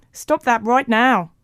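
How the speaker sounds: slow, high, loud recorded command; whirring tape voice. A narrator's reading. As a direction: imitate a tape playing back an order. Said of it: "whirred Mo's voice from Carl's tape recorder".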